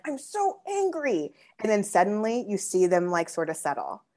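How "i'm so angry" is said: imitating an angry kid. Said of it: "a kid can be like".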